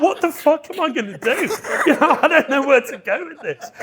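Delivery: high-pitched retold bewilderment; mock panic. Man telling of a handshake standoff, mock panic.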